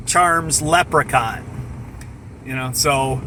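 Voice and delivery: usual speaking voice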